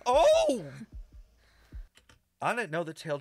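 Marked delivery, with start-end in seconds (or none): 0.1-0.6 short, loud high "oh!"; startled cry. Real, not acted. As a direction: yelp in shock. Startled by game scene.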